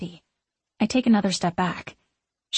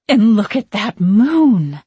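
loud, drawn-out witchy marvelling; grand, piercing witch admiring the sky; haughty witch in awe; 9 dB louder, slower than usual. A narrator's reading. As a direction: slowly savour it as an imperious witch.

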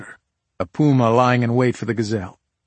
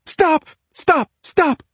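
very high, loud, sharp cries; three piercingly high bursts; screamed interruption; 5 dB louder, much slower than usual. A narrator's reading. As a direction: scream over him to shut him up. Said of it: "Claudia screamed".

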